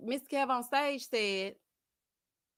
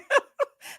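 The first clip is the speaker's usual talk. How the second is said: high cackle; laugh; 7 dB louder than usual. Real, not acted. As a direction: burst out cackling. Laughs at donut story.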